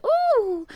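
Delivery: loud, high squeal of a jolt upward; high "ooh" on the way up. A narrator's reading. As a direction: yelp as you are flung upward. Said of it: "as he went up in the air".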